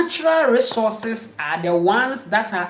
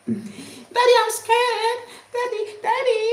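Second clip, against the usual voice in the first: very high, hammed-up child's cry; panicked child; 14 semitones higher than usual. Frightened child crying to father, very high hammed-up cry. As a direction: overplay a terrified kid calling dad.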